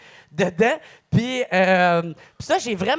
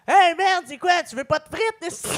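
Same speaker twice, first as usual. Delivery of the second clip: stoned guy; 10 semitones higher, 4 dB louder than usual. Retold or imitated. Stoned guy offering fries.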